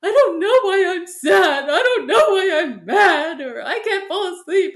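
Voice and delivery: high, loud mock wail of a child; imitating crying kid. Mom voicing her upset kids, mock crying. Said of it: "they're crying and they're like".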